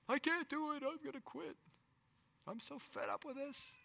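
high, crying whine of giving up; mock weepy falsetto; whiny quitter. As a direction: wail it like a quitter at the end of his rope. Quitter voiced again.

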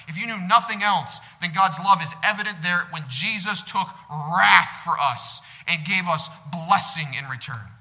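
loud, rising sermon voice, stretching key words; full-force preaching. Acted. Preaching God's love in Christ.

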